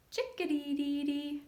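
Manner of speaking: high sung bird call; chickadee call. Shown as a demonstration. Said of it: "Chickadee is an acrobat".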